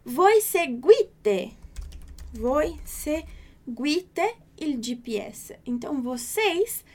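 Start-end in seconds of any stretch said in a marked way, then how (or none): 0.0-5.6 very slow, clear Italian drill; careful teacher pronunciation; very slow model reading. Shown as a demonstration. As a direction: stretch it out for students to repeat.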